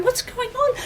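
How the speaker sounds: very high, squeaky alarm; agitated onlooker. Acted. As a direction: ask it in a high-pitched panic. Worried crowd grows agitated.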